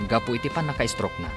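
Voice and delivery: normal talking voice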